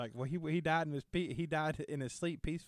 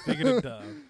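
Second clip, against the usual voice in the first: laughing; 10 semitones higher, 8 dB louder than usual. Real, not acted. Laughing echo of friend.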